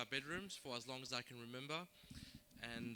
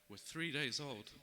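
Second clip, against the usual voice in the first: measured, declaimed verse with falling line ends; poem recitation; 6 dB louder, slower than usual. Acted. Poet reciting the story of Karbala to a gathering, solemn declamation. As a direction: recite it line by line, pausing after each phrase.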